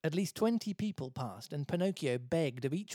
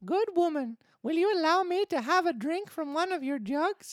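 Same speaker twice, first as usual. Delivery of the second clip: put-on very high, loud puppet-boy voice; thirsty Pinocchio; 6 dB louder than usual. Pinocchio asking the water lady for a drink, high pleading voice. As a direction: beg politely like a little puppet.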